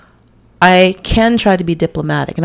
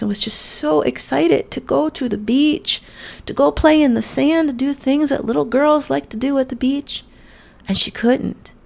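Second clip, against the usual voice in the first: choked up; 7 semitones higher than usual. Real, not acted. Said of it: "I'm getting tears in my eyes right now".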